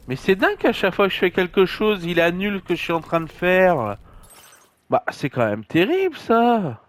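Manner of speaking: loud, fed-up complaining; exasperated. Real, not acted. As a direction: vent at the game's menus. Game cancels his actions, exasperated.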